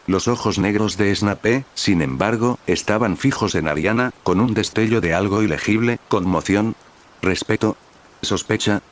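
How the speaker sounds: plain reading voice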